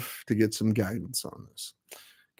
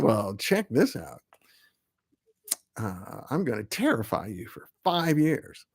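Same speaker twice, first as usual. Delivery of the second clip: mock menace; 3 semitones lower, 6 dB quieter than usual. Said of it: "said the world".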